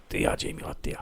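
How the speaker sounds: soft pitying whisper; mocking pity. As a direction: tease him gently. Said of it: "he goes".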